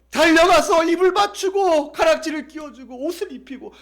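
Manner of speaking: high, loud preaching at full pitch; impassioned storytelling. Real, not acted. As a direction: tell it in a rush of fervor. Narrates the father's joyful welcome. (벅찬 감격으로 높고 힘차게)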